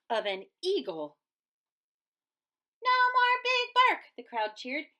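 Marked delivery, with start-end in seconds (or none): 2.8-4.1 high, loud cheer. Acted. Crowd cheering the bully gone, high and loud. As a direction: cheer with joy.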